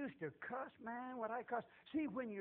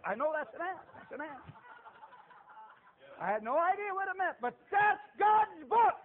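rejoice louder and louder; excited proclaiming; 6 semitones higher, 7 dB louder than usual. Real, not acted. Preacher recalling finding God's book, building to a joyful shout.

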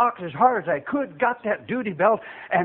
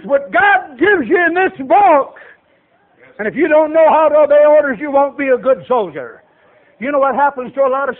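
raised, loud pulpit delivery; impassioned preaching; 6 semitones higher, 6 dB louder than usual. Real, not acted. Preacher tells church of God making soldiers, forceful exhortation. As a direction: preach it with force, building to a cry.

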